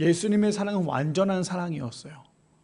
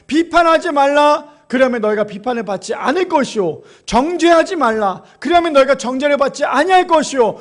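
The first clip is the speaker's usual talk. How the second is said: loud, raised pulpit voice, slowing on each command; fervent preaching; 6 semitones higher, 7 dB louder than usual. Acted. Pastor reading Luke to the church, loud fervent preaching. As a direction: proclaim the verses to the congregation.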